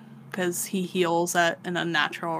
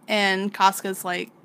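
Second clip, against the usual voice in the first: ordinary delivery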